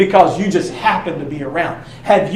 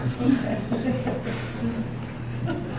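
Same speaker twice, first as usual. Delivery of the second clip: breathy laugh; 6 semitones higher, 11 dB quieter than usual. Real, not acted. Laughs at the joke.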